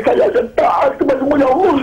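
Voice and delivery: tearful vow. Retold or imitated. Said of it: "hati saya menangis".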